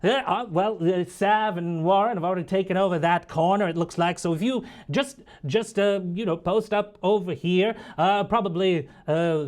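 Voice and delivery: lightly voiced scatterbrained scholar; professor. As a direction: dither through directions as a fussy academic. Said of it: "Um, and he".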